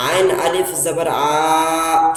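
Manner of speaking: آ کو کھینچ کر پڑھیں; drawn out vowel. Shown as a demonstration. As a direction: stretch the آ slowly and long. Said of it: "تھوڑا کھینچ کے پڑھیں گے".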